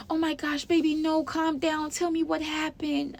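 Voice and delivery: high, soft, mock-tender voice; imitated soothing mom. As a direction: coo like a mother calming her baby. Voicing the mother soothing her crying daughter.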